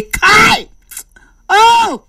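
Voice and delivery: high, drawn-out cry of dismay; hammed-up wail of disbelief; mock distressed exclamation. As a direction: exclaim high like a desperate husband. Retold husband exclaims in distress.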